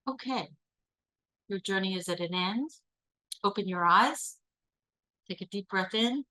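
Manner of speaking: calm facilitator voice; guided visualization. Acted. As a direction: lead the group through a daydream, gently.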